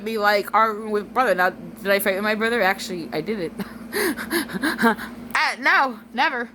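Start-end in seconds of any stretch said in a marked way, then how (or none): 3.6-5.3 soft chuckle; quiet run of laughter. Real, not acted. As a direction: laugh it off lightly. Laughs after joking about fighting.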